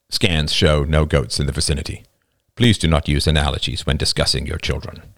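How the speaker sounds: low, level, impassive announcement; impassive angel. Acted. Angel corrects the humans, impassive. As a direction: state it evenly, without feeling.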